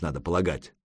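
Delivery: ordinary delivery, nothing marked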